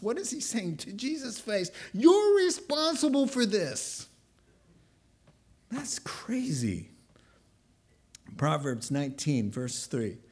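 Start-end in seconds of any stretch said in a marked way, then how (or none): 1.9-4.1 high, loud mock accusation; accusing. Gideon blames Jesus to his face, accusing. 5.7-6.9 slow, high whisper; hushed disbelief. Real, not acted. Preacher reacts to blaming Jesus, hushed disbelief.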